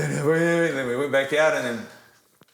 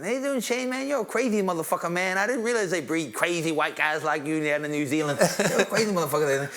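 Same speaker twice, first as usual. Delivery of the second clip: high-pitched, friendly boxer impression; admiring champ in a high voice; Mike Tyson; 5 semitones higher than usual. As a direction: imitate the champ greeting a sparring partner, pitched up. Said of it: "can I get Mike to sign".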